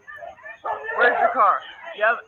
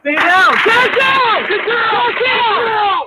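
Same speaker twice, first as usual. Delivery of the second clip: loud, high urgent order; shouted command; 8 semitones higher, 4 dB louder, much slower than usual. Real, not acted. Man shouts at crowd to stay down. Shouting at people during gunfire.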